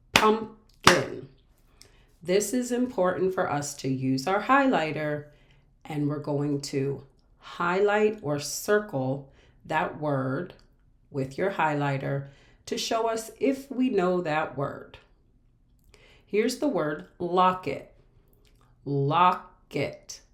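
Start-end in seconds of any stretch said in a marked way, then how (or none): 0.1-1.3 "pumpkin" in two loud beats, pump-kin; clapped-out syllables. Shown as a demonstration. Teacher clapping out a word, strong separate syllables. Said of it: "We can clap out". 18.9-20.2 low "locket", split lock-et; syllable by syllable. Shown as a demonstration. Teacher breaking a word for pupils, syllables apart. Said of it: "We can clap out that word".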